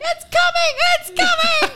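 loud, very high imitation of a frantic kid; panicked toddler. Toddler sent to sit on the potty, panicked high squeal. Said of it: "she'd be like".

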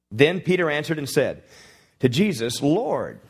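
preacher reading the Bible aloud with fervor; scripture reading. Acted. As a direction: proclaim the verse to the congregation.

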